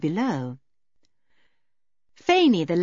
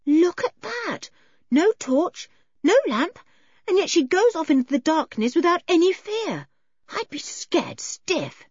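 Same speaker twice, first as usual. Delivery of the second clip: George amazed at fearless Eileen; awed girl's voice; 11 semitones higher than usual. Acted.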